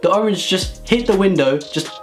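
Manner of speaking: ordinary delivery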